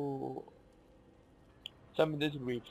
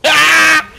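loud shriek breaking into laughter; panicked scream into laugh; 9 semitones higher, 15 dB louder than usual. Real, not acted. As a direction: scream then burst out laughing. Gamer dies, screams and laughs.

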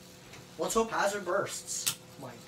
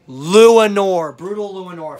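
booming, much louder name reveal; announcer call; 14 dB louder than usual. Real, not acted. Announces the next contender.

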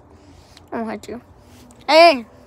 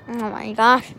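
ordinary talk, nothing marked

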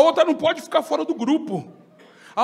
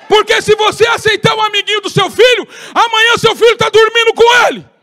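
much higher, louder shouted preaching; fiery preaching; 10 semitones higher, 9 dB louder than usual. Acted. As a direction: thunder the warning at the congregation. Preaching at full force.

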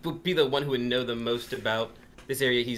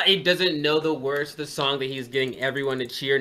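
usual speaking voice

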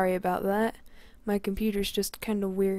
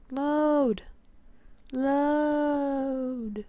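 raised, higher-pitched plea to load; impatient calling out; 5 semitones higher, 4 dB louder than usual. Real, not acted. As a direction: call it out at the stalled game, stretching the vowel. Impatient at slow loading game.

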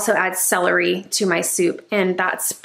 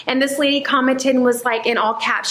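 usual speaking voice

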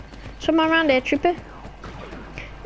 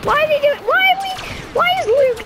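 shocked squeak; 11 semitones higher, faster than usual. Real, not acted. Teammate doing something baffling.

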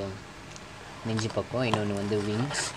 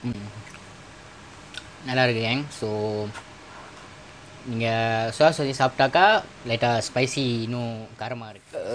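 talking with food in mouth; eating fries between words; chewing while talking; 3 dB quieter than usual. Real, not acted. Seen 2: Dips fries, brings them to mouth, talks while eating.

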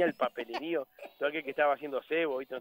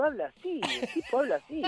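high put-on voice; imitación del compañero; 9 semitones higher than usual. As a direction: demonstrate the odd voice, high and stretched. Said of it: "Si no, boludo". (voz imitada, bien aguda)

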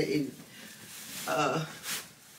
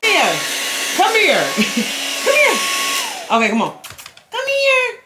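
much higher, louder pet-talk voice; 11 semitones higher, 7 dB louder than usual. Real, not acted. Owner praising her dog Denim while grooming, high cooing baby talk. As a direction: coo at the dog like a doting mom.

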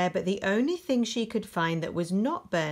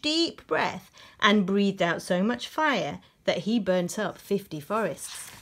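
plain reading voice

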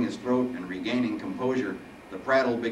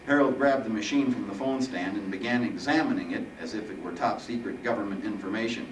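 ordinary delivery

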